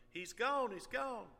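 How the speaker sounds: loud, high panicked cry; panicked yelling. Retold or imitated. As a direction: yell it across the beach. Kids yelling on beach.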